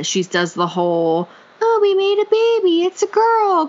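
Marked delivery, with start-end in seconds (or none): 1.6-3.7 put-on much higher, sing-song creepy baby voice; creepy baby talk. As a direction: coo it like an unsettling baby-talk mother. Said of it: "creepy baby talk".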